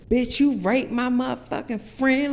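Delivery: yelled high and loud at the man; loud confrontation. Retold or imitated. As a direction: call him out in public, full voice. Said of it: "like, loud as shit".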